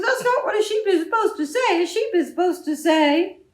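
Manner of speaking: high, loud correction; exasperated farmer. Acted. Farmer tells the sheep off, high and loud. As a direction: set the sheep straight.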